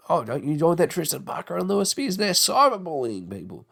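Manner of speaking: a lightly voiced nagging mum; mock mum. Mother warning her child off trolls, mild mock scold.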